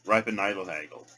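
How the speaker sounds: rattled off briskly; quick run-through. Shown as a demonstration. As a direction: say the three words fast as one phrase. Trying the phrase quickly.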